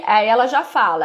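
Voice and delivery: ordinary talk, nothing marked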